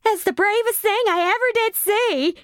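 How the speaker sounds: put-on high girlish voice in a lightly folksy Midwest accent. The girl in the front seat praising her, light Midwest accent. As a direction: gush it with a touch of Midwest accent. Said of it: "in some folksy Midwest accent".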